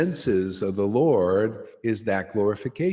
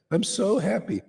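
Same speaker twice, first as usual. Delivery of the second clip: higher, giddy "I'm so happy"; mock giddy joy; 10 semitones higher than usual. Preacher mocking drunk mood swings, giddy voice. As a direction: swing up into silly delight on "happy". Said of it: "and the next day".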